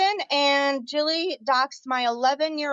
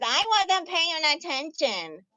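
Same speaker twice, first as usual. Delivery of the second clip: slow, higher-pitched mocking quote; mocking imitation; 5 semitones higher, slower than usual. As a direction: mimic her excuse, drawn out and high. Mocking quote of Bobby Joe.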